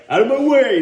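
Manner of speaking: angry shout. Retold or imitated. Rocky bursting past doctors, angry shout. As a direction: shove past them yelling. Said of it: "Rocky's like".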